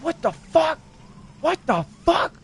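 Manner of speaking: high-pitched, retold shock; shocked disbelief. Reacting to what appeared on his screen, high-pitched shocked disbelief. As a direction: replay the shock, pitch leaping up on each question.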